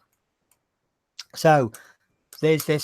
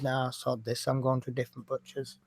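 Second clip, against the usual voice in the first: quiet aside; 2 semitones lower, 9 dB quieter than usual. Retold or imitated. Muttered reply leaving the shop.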